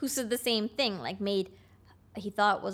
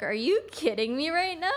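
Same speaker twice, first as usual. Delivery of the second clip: incredulous, higher-pitched comeback; retold incredulity; 7 semitones higher than usual. Woman retelling her reply to a crude text, incredulous. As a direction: lift it high in disbelief, as if retelling it.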